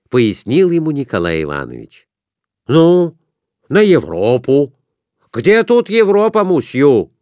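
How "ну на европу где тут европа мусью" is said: loud, higher, insistent; loud merchant. A narrator's reading. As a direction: push the bet on the croupier.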